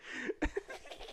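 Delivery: breathless high giggle fit; can't stop laughing, squeaky; high squealing laugh. Real, not acted.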